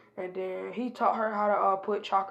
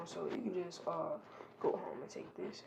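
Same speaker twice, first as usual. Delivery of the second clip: quiet; 3 semitones lower, 13 dB quieter than usual. Acted. Naruto quietly pays off guard.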